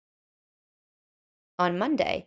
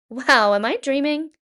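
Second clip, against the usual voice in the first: amazed; 7 semitones higher, 9 dB louder than usual. Acted. Painter wakes to paintings.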